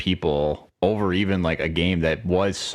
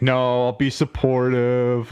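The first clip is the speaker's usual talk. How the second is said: mock supportive; 6 semitones higher, slower than usual. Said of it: "I'm like".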